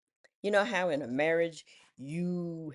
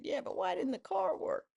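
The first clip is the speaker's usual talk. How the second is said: baffled question; 12 semitones higher than usual. Retold or imitated.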